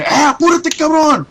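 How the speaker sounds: high, loud Spanish shout to hurry up; dad yelling. Retold or imitated. Dad yells at son to hurry and cut, high loud shout. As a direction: bark it like an impatient father. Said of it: "my dad started yelling".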